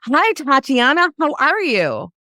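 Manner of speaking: bright, high sales greeting; chirpy greeter. Retold or imitated. To a stranger, in a chirpy clerk greeting. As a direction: sing it out like a store clerk.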